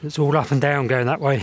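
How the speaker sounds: ordinary talk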